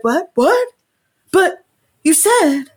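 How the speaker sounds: high, rising confused disbelief; baffled what. Retold or imitated. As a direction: play the guy caught off guard, voice jumping up. Confused guy voiced in retelling.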